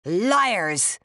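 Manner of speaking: a loud furious cry; angry shout. A narrator's reading. Rosaleen screaming at the narrator in anger, a loud furious cry. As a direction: hurl the word out in fury. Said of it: "she shouted out with such anger".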